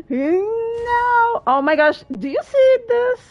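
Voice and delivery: high, loud alarmed cry; a sudden jolt of alarm at the screen; shocked squeal. Real, not acted. Streamer panics at a hole in the game, alarmed.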